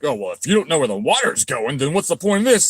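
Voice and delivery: whiny mock voice of a know-it-all; mocking an internet critic. Mocking internet commenters who doubt his design. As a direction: sneer it like a wannabe expert. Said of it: "wannabe dumbass on the internet is like".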